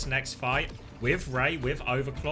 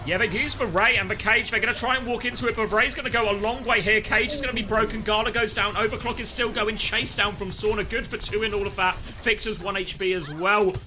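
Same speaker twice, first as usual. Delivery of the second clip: quick, raised caster call; lively play-by-play; 6 semitones higher, 4 dB louder than usual. Acted. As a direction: narrate the fight as it happens. Live play-by-play of fight.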